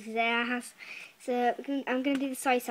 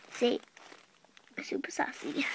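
whispered; 2 semitones higher, 3 dB quieter than usual. Acted. Whispering label again.

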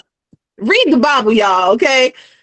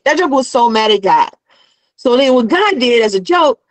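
ordinary talk

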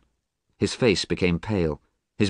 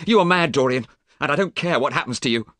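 fast, higher and louder, pushing him away; angry refusal; 8 semitones higher, 4 dB louder, faster than usual. Acted. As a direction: snap back a flat refusal. Alan scorns Dorian.

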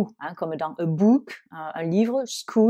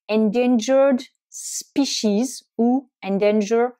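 American pronunciation; 3 semitones higher, slower than usual. Shown as a demonstration. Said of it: "la prononciation américaine".

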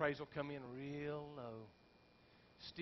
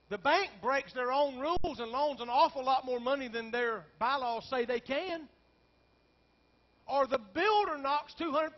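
high, loud preacher's cry; preaching at full force; 9 semitones higher, 11 dB louder than usual. Acted. Pastor tells congregation his despair, loud preaching cry. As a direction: lift the congregation with it.

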